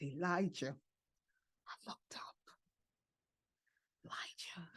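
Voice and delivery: very soft, reverent hush; hushed divine voice. Retold or imitated. Woman recalling the voice she heard, hushed. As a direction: say the name barely above a breath. Retells hearing God's voice.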